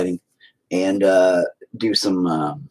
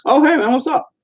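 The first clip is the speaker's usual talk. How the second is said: high cheerful greeting; 4 dB louder than usual. Real, not acted. Greeting a viewer.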